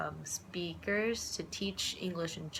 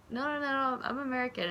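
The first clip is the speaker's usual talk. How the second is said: high protest; 7 semitones higher than usual. Retold or imitated. She protests to vendors.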